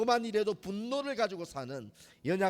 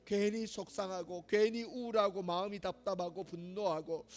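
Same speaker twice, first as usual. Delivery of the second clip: quiet prayer; 3 dB quieter than usual. Acted. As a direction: begin praying softly before God. Opens united prayer.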